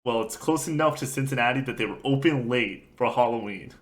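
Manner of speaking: ordinary delivery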